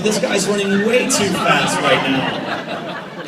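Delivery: laughing. Retold or imitated. Dad laughing at his rival's pace, slow and high. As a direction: chuckle it out slowly, mock alarm. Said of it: "I'm like".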